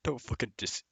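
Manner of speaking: ordinary delivery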